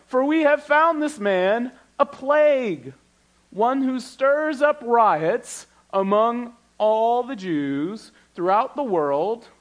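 slow, high, ringing Bible reading; preacher reading out the charge against Paul, slow and lifted; dramatic scripture reading. Acted. Pastor voicing Tertullus's charge to the congregation, slow and lifted.

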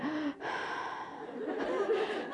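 high, breathy mock sigh, laughing; child's sigh. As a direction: copy the girl letting out a long breath. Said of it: "and she went".

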